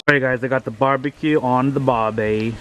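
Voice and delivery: a hammed-up, bad Australian accent; awful Australian accent. Vlogger jokes about the barbie at the grill, in a bad Australian accent. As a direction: put on a cheesy Australian twang for the joke. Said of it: "Excuse my awful Australian accent".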